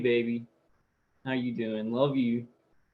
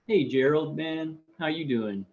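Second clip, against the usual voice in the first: ordinary talk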